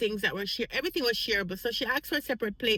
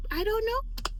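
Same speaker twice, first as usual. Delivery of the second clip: high flustered reply; 12 semitones higher, slower than usual. Retold or imitated. Flustered answer to coworker.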